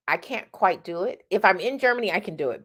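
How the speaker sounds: usual speaking voice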